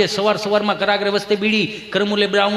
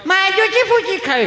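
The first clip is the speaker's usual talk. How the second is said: put-on very high baby-talk; mock small-kid voice; little child; 11 semitones higher than usual. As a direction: beg like a toddler. Acts small child answering.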